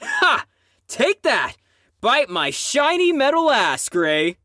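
high, crowing victory taunt; triumphant gloat. Acted. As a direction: crow over the win.

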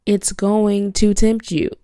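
ordinary talk, nothing marked

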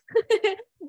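sudden high, loud laugh breaking out; high burst of laughter. Real, not acted. Laughing at her joke about conditions on her husband, high burst of laughter. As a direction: laugh out loud at your own cheeky joke.

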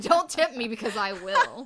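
loud, playful threat; hammed-up warning, pushed out loud; mock menacing threat. Husband answers his wife's teasing with a loud mock threat.

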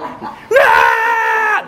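put-on shrill high scream of a hurt child; piercing kid's cry of pain; child's pain scream. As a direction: wail like a toddler getting stitches. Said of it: "That's the response".

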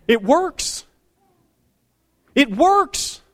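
high-pitched, amazed realization; elated exclamation. Retold or imitated. Boss reacting to his employee's loyalty, in an elated high exclamation. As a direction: exclaim it like a happy discovery.

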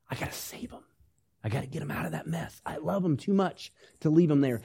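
whispered, then softly pleading resolve; a whisper of tender determination; hushed voice of God. Acted. Voicing God's inner resolve.

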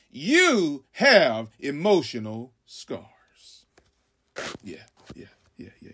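slow, unvoiced whisper. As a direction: hush it down to a breath. Drops to hushed aside.

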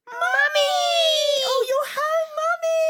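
very high, loud overjoyed cry; joyful cry. Acted. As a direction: cry out with relief. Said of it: "And the girls cried".

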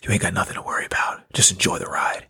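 low, half-whispered reassurance; imitated whisper, low and confiding; whisper in the ear. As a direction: murmur it close, like a secret into someone's ear. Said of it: "he whispered in my ear".

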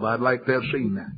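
normal talking voice

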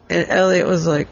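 ordinary delivery

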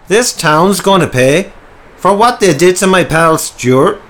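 loud, high-pitched threatening snarl; angry snarl. A narrator's reading. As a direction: snarl it out as a vengeful thug. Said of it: "snarled Simon the skinhead".

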